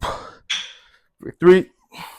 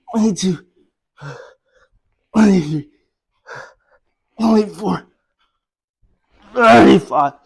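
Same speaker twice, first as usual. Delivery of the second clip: strained counting on the last reps; straining effort; 3 semitones higher, 3 dB louder than usual. Real, not acted. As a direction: grind out the final numbers. Straining through final reps.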